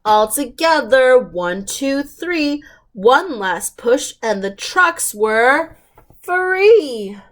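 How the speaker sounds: ordinary delivery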